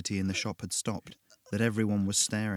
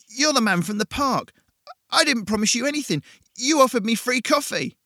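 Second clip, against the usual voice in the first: panicked protest; 14 semitones higher, 8 dB louder than usual. Acted. Jason panicking at the devil.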